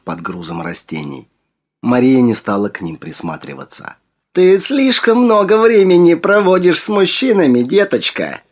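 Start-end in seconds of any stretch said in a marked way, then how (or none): 4.3-8.4 high, loud scolding crone voice; reproachful old woman. A narrator's reading. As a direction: scold her like a wise old hag. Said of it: "укоризненно проговорила старуха".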